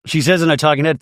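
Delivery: normal talking voice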